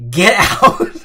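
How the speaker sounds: a big, loud, playful "get out"; mock shout. Streamer shouting in joking disbelief at a chat message.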